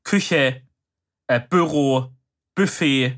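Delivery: "Küche, Büro, Buffet" with short stressed first syllables; short first vowels. Shown as a demonstration. Southerner giving examples of his dialect, short first vowels. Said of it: "macht man's kurz".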